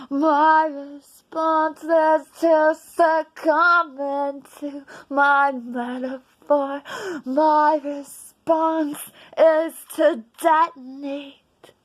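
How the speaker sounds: loud declamation. Acted. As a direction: proclaim it to the back row. Poem's final climax.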